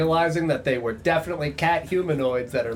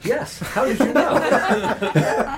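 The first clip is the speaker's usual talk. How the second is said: laughing; 9 semitones higher, 4 dB louder, much slower than usual. Acted. Delighted at the field hockey guess.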